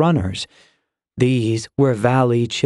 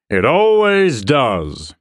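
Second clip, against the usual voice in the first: slow, drawn-out boom; slower than usual. A narrator's reading. Garros answering before the young soldiers, slow booming voice. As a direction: boom it out slowly, swelling then falling. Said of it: "Garros boomed".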